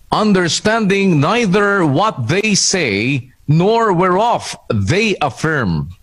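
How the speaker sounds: usual speaking voice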